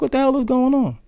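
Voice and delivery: high-pitched replay of own bafflement; bewildered reaction. Retold or imitated. Streamer recalling the stream glitch, high mock bewilderment. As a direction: voice your confusion way up high. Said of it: "I'm like".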